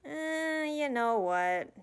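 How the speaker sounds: loud, high, drawn-out deliberation; long high hesitation sliding into a decision; making up her mind. Acted. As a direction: stretch it out as you decide. Makes up mind about the proposal.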